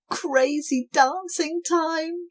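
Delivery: high, slow and gleeful; excited shout. Acted. Cobwobblies calling everyone to dance. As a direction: announce the party. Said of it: "shouted the cobwebs".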